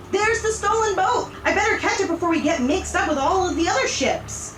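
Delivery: storybook shouting; mock screaming. A narrator's reading. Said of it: "he screams to the passengers".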